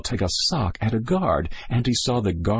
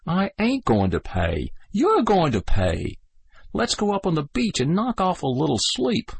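higher, cocky soldier voices; young soldiers bantering; 11 semitones higher than usual. Acted. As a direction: toss the bet back and forth. Voices Sligo and the kid.

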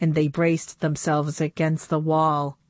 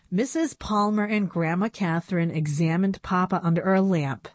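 plain reading voice

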